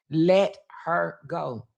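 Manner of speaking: LET, HER, GO drawn out slowly, word by word; slow, deliberate emphasis. Retold or imitated. Father telling mother "Let her go", slow and deliberate. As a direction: space out "Let her go" at half speed.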